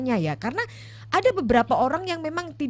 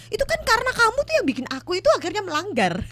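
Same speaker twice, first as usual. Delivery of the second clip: mock blaming; 11 semitones higher than usual. Spouse blaming partner for broken commitment.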